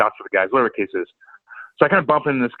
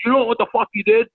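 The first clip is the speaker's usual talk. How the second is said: mock stern boss, high; 11 semitones higher than usual. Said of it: "and goes".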